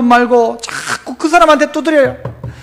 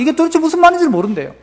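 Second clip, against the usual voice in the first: usual speaking voice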